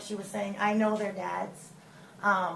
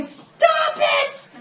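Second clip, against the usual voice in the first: very high, loud screech of protest; high-pitched, shouted intervention; mock yell; 9 dB louder than usual. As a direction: imitate someone yelling to break up a fight. Pastor yelling in the middle.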